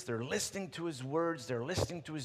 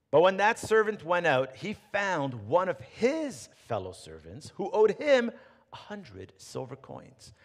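louder storytelling read; scripture reading; 5 dB louder than usual. Acted. Preacher reading the parable to the congregation, storytelling. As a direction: tell the parable with weight.

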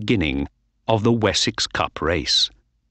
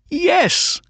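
cheering. A narrator's reading. Narrator cheering the race.

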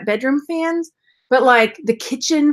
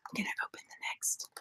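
very soft, unvoiced whisper with long pauses; ASMR whisper; 4 semitones lower, 17 dB quieter than usual. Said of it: "we'll A S M R the next one".

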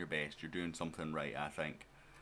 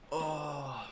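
high, drawn-out "oh" of dismay; disappointed groan; 8 semitones higher than usual. Real, not acted. Fan watching his team miss the winning chance, disappointed groan.

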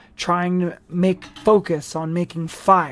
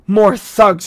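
loud, hostile growl; 3 semitones higher, 8 dB louder than usual. A narrator's reading. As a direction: snarl it at the newcomers. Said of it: "she growled".

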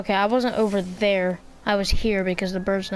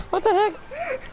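laughing; 12 semitones higher, slower than usual. Real, not acted. Laughing at drowning boar.

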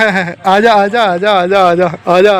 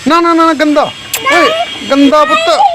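high scolding; 8 semitones higher than usual. Real, not acted. Scolding dog for dirty clothes.

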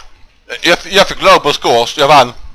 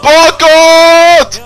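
much higher, louder shouted bakåt!; shouted echo; 5 dB louder than usual. Pretend. Child shouts bakåt! back at the song, high and loud.

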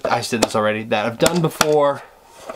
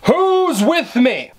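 higher, louder, slowly stretched rally cry; rallying shout; 8 semitones higher, much slower than usual. Pretend. Rallying his imaginary crew with a big shout. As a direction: rouse the troops, "Who's with me?" drawn out. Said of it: "angry and loud".

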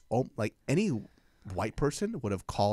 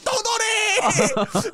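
very high, drawn-out shriek; screaming mom; 8 dB louder, much slower than usual. Retold or imitated. Mom still insisting to her son, screaming. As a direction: stretch it into a long, loud mock scream. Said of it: "they're screaming at each other".

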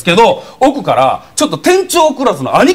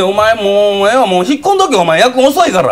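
追い払う兄貴; 5 semitones higher than usual. Retold or imitated. 兄貴が店員を追い払う.

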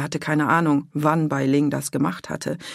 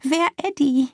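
much higher, teasing broken German; giggling young woman; 11 semitones higher, 3 dB louder than usual. A narrator's reading. Bai Ling teasing Pitt about Eddie, high and giggly. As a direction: giggle the question. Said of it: "sagte sie und kicherte".

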